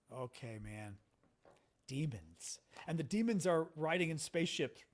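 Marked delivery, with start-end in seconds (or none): 0.1-2.6 low, much softer, doubtful aside; underwhelmed. Retold or imitated. Listener humouring a demon believer, quietly skeptical. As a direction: drop the voice and humour him quietly. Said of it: "And you're like".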